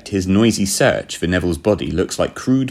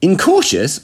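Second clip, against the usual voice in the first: high, louder incredulous echo; utter amazement; 11 semitones higher, 5 dB louder, slower than usual. A narrator's reading. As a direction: throw the word back, astonished. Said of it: "my mind tumbled into utter amazement".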